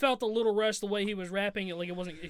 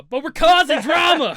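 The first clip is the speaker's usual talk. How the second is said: playful exclaim; 8 semitones higher, 12 dB louder, slower than usual. Real, not acted.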